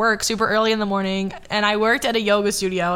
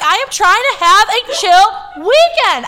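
high, loud and slow, fed up; exasperated; 9 semitones higher, 7 dB louder, slower than usual. Retold or imitated. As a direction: spell it out in exasperation. Exasperated quote of self.

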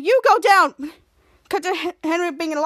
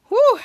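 relieved whew; 9 semitones higher, 8 dB louder than usual. Retold or imitated. Ray relieved about teenage girls.

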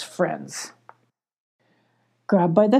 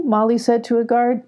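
ordinary delivery, nothing marked

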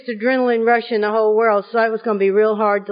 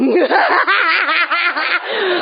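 high triumphant cackle; gleeful cackle; 5 semitones higher than usual. Retold or imitated. Wife cackles after hanging up on husband. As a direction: cackle with glee at the revenge to come.

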